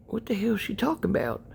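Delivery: whispered aside to himself; baffled whisper. Retold or imitated. Dad eavesdropping at his daughter's door, baffled whisper. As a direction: whisper it, puzzled.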